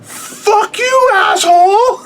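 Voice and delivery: high, cracking, laughing mock yell; mock outburst. Husband retelling his reply to wife, high laughing mock yell. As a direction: shriek it at her, half laughing. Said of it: "And I'm like".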